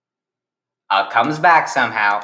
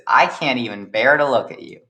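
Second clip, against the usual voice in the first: ordinary delivery, nothing marked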